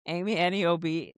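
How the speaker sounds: usual speaking voice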